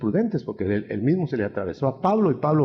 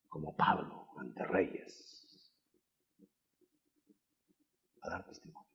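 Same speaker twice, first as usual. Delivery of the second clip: hushed solemn close; 5 semitones lower, 13 dB quieter, slower than usual. Real, not acted. Sermon point trailing softly.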